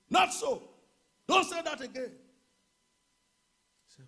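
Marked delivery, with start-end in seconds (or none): 0.1-2.1 loud, high commanding shout; shouted rebuke. Acted. Pastor rebuking sickness before the congregation. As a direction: cut the lie off hard.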